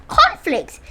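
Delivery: high, loud disbelieving cry; exclaimed. A narrator's reading. Narrator to listeners, astonished cry. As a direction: shout it in amazement at the daftness.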